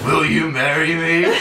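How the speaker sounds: slow, high, laughing proposal; mock proposal. Acting out Johnny proposing on one knee, comically sappy. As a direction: draw it out with hammed-up romance. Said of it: "and proposes".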